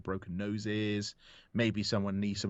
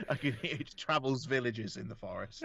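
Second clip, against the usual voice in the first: ordinary delivery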